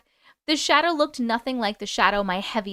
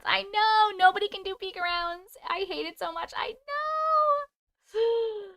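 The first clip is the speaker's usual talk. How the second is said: mock whine; 9 semitones higher than usual. Whining about peek-arounds.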